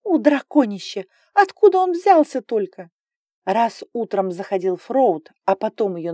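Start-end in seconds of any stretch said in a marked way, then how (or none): 0.0-2.9 indignant outburst. A narrator's reading. Heroine fumes at dragon.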